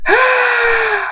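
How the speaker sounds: long, very high yell; battle scream. A narrator's reading. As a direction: scream with full effort. Battle scream, throwing rainbow back.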